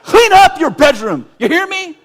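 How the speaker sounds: put-on screaming at a child to clean up; yelling parent. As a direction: bellow it at the kid like an enraged parent. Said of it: "And I yell".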